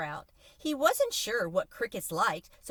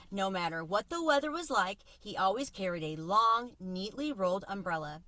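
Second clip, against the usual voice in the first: usual speaking voice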